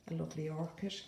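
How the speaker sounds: normal talking voice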